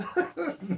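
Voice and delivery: high burst of laughter. Real, not acted. Laughing at the punchline.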